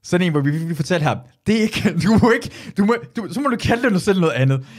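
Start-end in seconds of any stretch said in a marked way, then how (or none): 1.5-4.7 louder, higher telling-off with a laugh; mock scolding. Comedians' court tells a hack to quit, loud mock scold. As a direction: scold him like a comedy tribunal.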